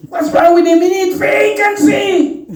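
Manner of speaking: very high, loud mock cursing; high-pitched exclamation, stretching the last word out long; mock outburst. Speaker acts out using frequency as the F word, mock-indignant yell.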